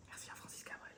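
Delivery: breathy whispered thanks. Pretend. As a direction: whisper it like a secret. Whispered aside after song.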